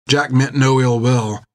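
usual speaking voice

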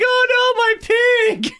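high squeaky wail over a lost pig; hammed-up high-pitched panic; mock cry of dismay. Boyfriend's cry quoted.

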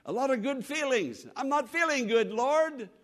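high, plaintive mock prayer; complaining to God. Doubting believer to the Lord, complaining plea. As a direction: plead your grievance to the Lord. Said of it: "You may think".

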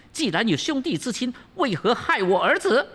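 high, bitter demoness demanding answers; high shrill mother's reproach; accusing princess. Acted. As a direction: accuse him of harming your son.

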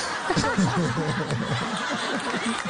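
carcajada grave y larga; risa encantada. Real, not acted. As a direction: se ríe derretida por el acento. Risa tras imitar al odontólogo. (risa encantada al recordar al odontólogo)

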